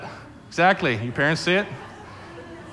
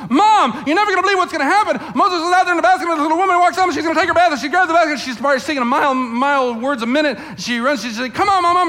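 much higher, louder, rushing words; breathless excited girl; 11 semitones higher, 5 dB louder, faster than usual. Retold or imitated. Miriam runs in to tell Mom, breathless and excited. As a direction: blurt it out, bursting with news. Daughter bursts in with news.

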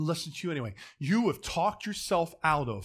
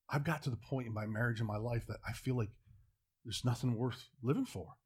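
soft, lower, retold confiding voice; furtive confession; 4 semitones lower, 7 dB quieter than usual. Manager confessing once no one's looking, furtive confession. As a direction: confide it quietly, as if nobody should hear. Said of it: "making sure no one's looking".

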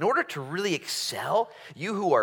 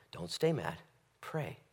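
ordinary talk, nothing marked

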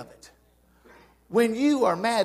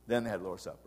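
softer repeat of "Then they had the Lord's Supper", almost to himself; quiet echo; 10 semitones lower, 10 dB quieter, much faster than usual. Shown as a demonstration. Preacher to congregation, repeating the point as a quiet aside. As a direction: let the line fall away into a murmur.

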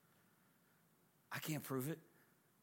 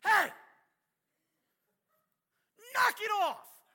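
much higher, louder rebuke; commanding shout; 9 dB louder than usual. Retold or imitated. Jesus rebuking the wind and waves, commanding shout. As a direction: bark at the storm to stop. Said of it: "and he goes".

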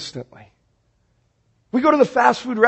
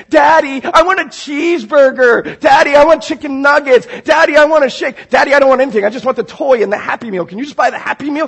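put-on pestering kids; 6 semitones higher, 3 dB louder than usual. Hungry kids begging Daddy in the drive-through, whiny pestering. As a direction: imitate children nagging, high and loud.

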